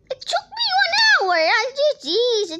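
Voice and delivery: high wailing complaint; crying out. Real, not acted. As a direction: wail it, upset and loud. Child upset over lost hour.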